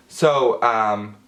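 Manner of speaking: ordinary talk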